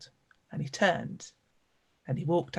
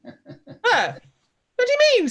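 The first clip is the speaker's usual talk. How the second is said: much higher and louder, with a scoffing laugh; affronted surprise; 9 dB louder than usual. Acted. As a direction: laugh it off in indignant disbelief. Artist taken aback by criticism.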